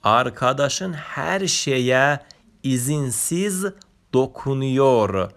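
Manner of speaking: arkadaşın her şeye izinsiz dokunuyor read very slowly word by word; very slow Turkish reading. Shown as a demonstration. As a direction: spell the Turkish sentence out slowly for learners.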